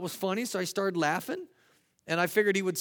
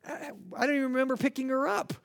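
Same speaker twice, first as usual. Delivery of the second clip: high-pitched, stammering bafflement; mock puzzlement; 8 semitones higher than usual. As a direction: stammer in playful disbelief. Acted puzzlement at child in arms.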